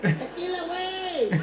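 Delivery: high, drawn-out bar cheer; rowdy party yell. Acted. Mocking drinkers in a dive bar, rowdy high yell.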